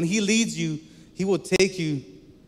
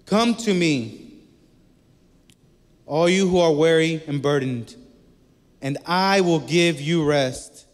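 scripture reading; 5 dB louder than usual. Acted. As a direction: proclaim the verse like a promise. Reads Matthew eleven aloud.

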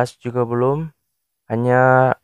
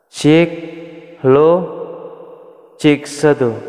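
mic test with echo effect; 3 semitones higher, slower than usual. Shown as a demonstration. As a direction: test words ringing with reverb. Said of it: "echo-nya itu di setengah".

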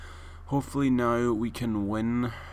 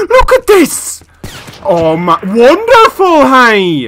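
very loud, high, stretched outburst; exasperated yelling; 10 semitones higher, 14 dB louder, slower than usual. Real, not acted. Player showing viewers his failure, exasperated yelling. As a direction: yell it out in outrage.

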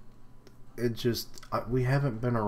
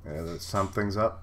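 usual speaking voice

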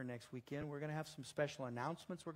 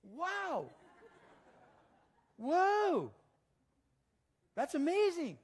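amazed exclaiming; 9 dB louder, much slower than usual. Retold or imitated. And I'm going.

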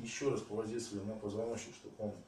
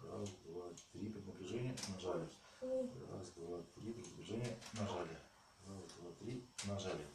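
soft counting; 3 semitones lower, 9 dB quieter than usual. Shown as a demonstration. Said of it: "надо будет в каждом разе".

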